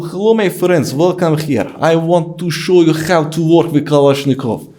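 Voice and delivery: loud, high, thick Russian accent; fake Russian accent. Shown as a demonstration. Guest demos Kalashnikov lesson for the host, hammed-up Russian accent. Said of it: "I can fake a Russian accent".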